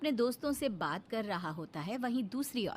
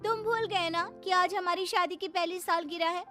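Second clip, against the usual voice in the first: higher-pitched, aggrieved scolding; upset wife; 9 semitones higher than usual. Acted. Wife to husband over the forgotten anniversary, upset. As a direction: reproach him as a let-down wife. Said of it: "इस तरह से प्रीति नाराज होकर".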